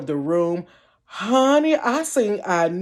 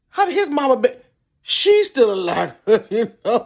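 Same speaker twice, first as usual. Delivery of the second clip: normal talking voice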